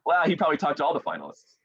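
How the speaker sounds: high, quick mock brush-off; imitating dismissive family. Parents and brother doubting the winner's news, imitated dismissal.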